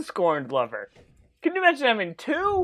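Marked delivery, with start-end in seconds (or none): 1.4-2.6 incredulous. Real, not acted. Incredulous about two lovers.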